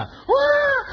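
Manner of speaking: surprised exclamation. Retold or imitated. Sudden realization in story.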